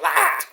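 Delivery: short high mock scream; Lince Dorado. Retelling the wrestler getting bitten, mock pained yelp. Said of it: "He's like".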